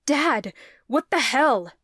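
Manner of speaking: loud, slow, furious demand; angry demand. A narrator's reading. Son confronting his father in the square, angry shout. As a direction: demand an answer, outraged. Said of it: "Lucas demanded".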